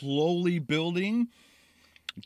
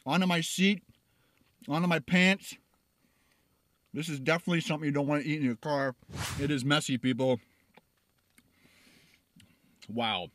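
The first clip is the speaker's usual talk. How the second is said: ordinary talk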